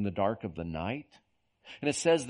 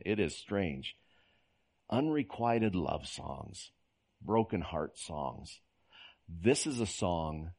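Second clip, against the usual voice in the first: ordinary delivery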